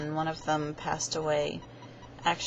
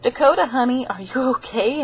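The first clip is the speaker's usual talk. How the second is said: high, louder sweet-talking concern; concerned coaxing; 8 semitones higher, 6 dB louder than usual. Retold or imitated. Asking her friend if he is okay, high and coaxing. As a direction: coax him gently like a worried friend. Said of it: "none, own words to Dakota".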